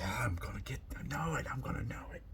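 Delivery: whispered and quick, half to himself; an eager student whispering to himself. Acted.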